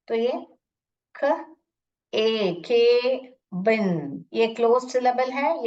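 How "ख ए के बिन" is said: ख, ए, के, बिन slowly, piece by piece; stretched के, separate falling बिन; sounding out. Shown as a demonstration. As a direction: blend "kh" and long "ay" into के, then बिन. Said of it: "पहला try कर रही हूँ".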